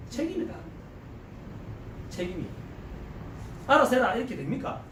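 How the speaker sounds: ordinary delivery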